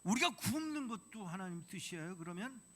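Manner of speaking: 높이 따지며 항의; 억울해서 크게 대드는 할아버지; angry protest. Retold or imitated. Grandfather arguing with God. (높이 따지는 항의)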